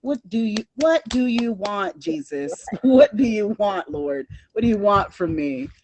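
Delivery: a hammed-up what do you want; exasperated. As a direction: throw up your hands at the Lord. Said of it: "I was like".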